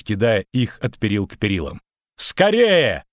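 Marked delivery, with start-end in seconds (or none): 2.2-3.0 high cry to hurry; sharp rise in urgency; urgent shout. Acted. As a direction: urge them on in a raised voice. Urging flight over collapsing bridge.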